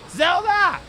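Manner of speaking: loud, high call across the house; shouting for the dog. Real, not acted. Owner shouting at barking poodle, loud call. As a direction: yell her name to stop the barking.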